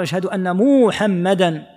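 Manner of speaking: "محمدا" with first syllable held, "muuuhammadan"; overlengthened Muhammad. Shown as a demonstration. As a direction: slowly stretch the "mu" of "محمدا". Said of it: "ما يقال". (إشباع ميم «محمدا»: مووحمدًا)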